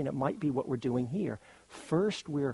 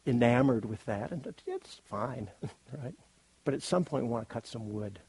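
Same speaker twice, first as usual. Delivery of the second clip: normal talking voice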